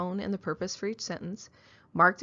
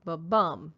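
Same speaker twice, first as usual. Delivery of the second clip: ba-bum with stress on the second beat; iambic beat. Shown as a demonstration. Teacher showing iambic meter to class, ba-bum. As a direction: tap out ba-bum, weak then strong. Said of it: "iambic pentameter is ba-bum".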